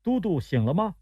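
high-pitched, discreet enquiry; calling servant. A narrator's reading. Attendant outside the tent checking on commander, calling servant.

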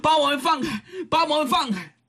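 high-pitched indignant demand; angry, embarrassed cry. A narrator's reading. Two captive girls demanding to be released, angry embarrassed cry. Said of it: "又气又臊啊".

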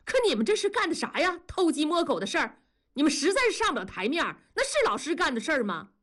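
high, rapid-fire accusation. A narrator's reading. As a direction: jump up in pitch and berate them fast. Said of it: "庄嫂的声音突然就拔高了". (突然拔高嗓门，连声质问)

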